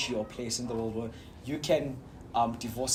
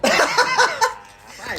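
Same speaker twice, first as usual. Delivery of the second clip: loud laugh; 7 dB louder than usual. Real, not acted. Closing banter laugh.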